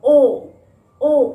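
loud long ओ like an amazed oh; ओ as a gasp of wonder; surprised oh. Shown as a demonstration. Teacher showing the surprised oh action. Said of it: "दोनों एक long sound create कर रहे हैं".

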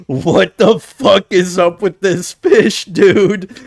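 loud, high freaked-out yell; real horrified outburst; disgusted shout. Real, not acted. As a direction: recoil at the gross fish and shout. Freaked out by crying fish.

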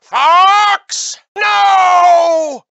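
long, high, drawn-out cry of horror; anguished shout. Acted. Pilot calling out to Fox in danger, anguished shout. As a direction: cry out desperately, stretching each word.

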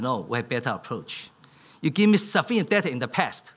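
ordinary talk

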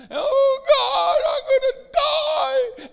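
high, loud, slow hammed-up wail; mock wailing. Believer wailing to God for rescue, hammed-up cry. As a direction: wail it like you're dying, overdone.

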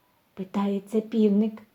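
usual speaking voice

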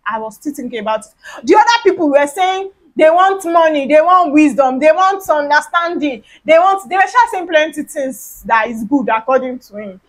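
ordinary delivery

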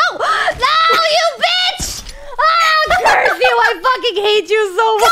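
raging yell at a rival; furious shout. Real, not acted. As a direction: scream it, furious. Rival steals the chest.

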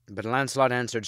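ordinary delivery

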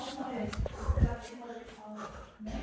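hushed, slowed search muttering; whispering while searching. Real, not acted. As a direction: keep it very soft. Quiet aside while searching.

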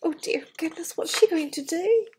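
usual speaking voice

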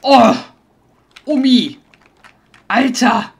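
loud, high frightened cries; startled shriek. Real, not acted. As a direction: jump out of your skin. Startled by fast enemy.

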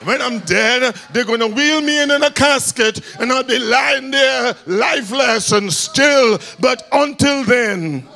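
high, shouted exhortation; preaching at full force. Acted. Preacher urging the men to make noise, shouting high. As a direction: drive it home, climbing.

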